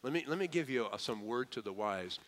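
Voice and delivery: normal talking voice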